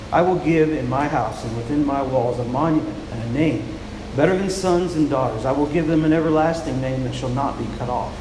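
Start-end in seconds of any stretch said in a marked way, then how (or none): none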